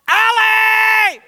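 high, very loud screaming name; furious yell. Acted. Older sister screams for Allie over the makeup, furious. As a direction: scream the name across the house. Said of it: "Brooke yelled".

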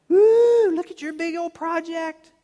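high, loud mock-awed "ooh"; squealed sarcastic admiration; mocking God. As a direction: gush with fake amazement. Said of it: "see God mocking them".

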